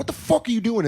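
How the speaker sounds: high confronting yell at mom. Retold or imitated. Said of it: "I was just like".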